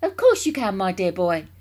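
loud, confident reassurance; hearty, overexcited father; excited cry. A narrator's reading. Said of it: "cried Mister Cranky".

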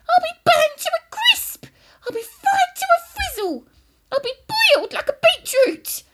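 high, loud wail of outrage; old woman yelling. A narrator's reading. Grandma telling George she is burning up, shrieking. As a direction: screech each dreadful fate in turn. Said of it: "she yelled".

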